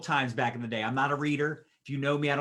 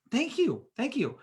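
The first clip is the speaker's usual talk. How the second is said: cheery mock thanks; 9 semitones higher than usual. Him cheerfully thanking a critic.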